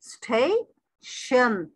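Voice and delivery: Station very slowly, as stay... शन; teacher's two-beat station; split into two syllables. Shown as a demonstration. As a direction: stretch both halves of station with a gap between. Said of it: "दो syllable हो गए".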